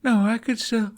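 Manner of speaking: tired, cut-off protest; drowsy and fading; sleepy protest. Acted. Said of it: "cut off by his own yawn".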